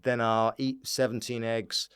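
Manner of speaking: ordinary delivery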